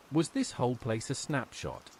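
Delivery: plain reading voice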